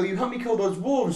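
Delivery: put-on high-pitched pleading with a toddler; coaxing parent. Dad reminding his daughter of their game, high coaxing voice.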